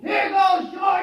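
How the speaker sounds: loud, high yell as he throws; shouting a challenge. Acted. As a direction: hurl the name out with the rock. Throws a rock, yelling.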